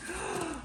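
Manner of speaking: quick intake of breath; sharp surprised gasp. Real, not acted. Vlogger gasping at the bag's interior, sharp gasp. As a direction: gasp as if struck by something lovely.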